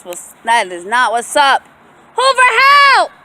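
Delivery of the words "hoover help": high, loud, hammed-up cry for help; mock distress scream calling the dog. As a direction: pretend to be in danger to test the dog.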